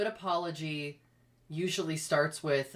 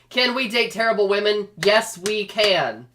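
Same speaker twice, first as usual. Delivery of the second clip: mock rally speech; 4 semitones higher, 9 dB louder than usual. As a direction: proclaim it like a campaign chant. Mock campaign chant.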